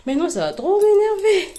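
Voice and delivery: high, loud mock outrage; exasperated cry. Real, not acted. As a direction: squeal it in playful annoyance. Imagining clothes touched, outburst.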